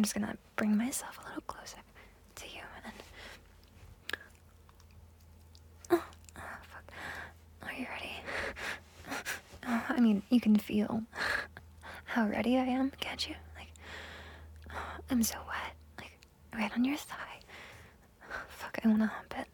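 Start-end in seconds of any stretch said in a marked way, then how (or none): none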